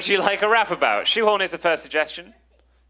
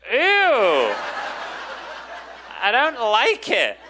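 disgusted; 5 semitones higher, much slower than usual. Real, not acted. Disgust at the word.

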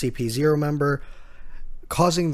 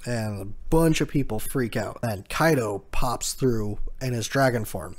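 ordinary talk

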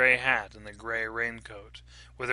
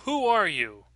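higher, insistent question; demanding; 7 semitones higher, 4 dB louder than usual. A narrator's reading. Mother challenging the stranger at the door, demanding. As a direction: demand to know who is there. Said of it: "she demanded".

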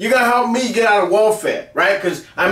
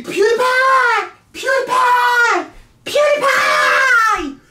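much higher, slow sung-out yell; mock falsetto shout; much slower than usual. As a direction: stretch the name out in a silly high wail. Mocking shout of PewDiePie's name.

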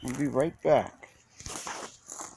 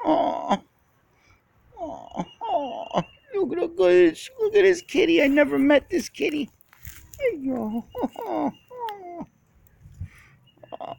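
high cooing pet voice. Real, not acted. Cooing at a cat.

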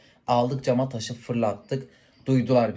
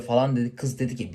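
ordinary talk

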